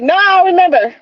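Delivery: playful denial. Retold or imitated. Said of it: "And I'll be like".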